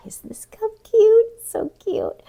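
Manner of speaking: very high squeal over the cup; cooing. Real, not acted. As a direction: gush like it's adorable. Gushing over a cute cup.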